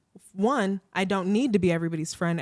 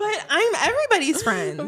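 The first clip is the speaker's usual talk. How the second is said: much higher, laughing protest; mock indignant kid; 12 semitones higher, 4 dB louder than usual. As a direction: play the little boy refusing to believe it. S1 mimics son's indignation.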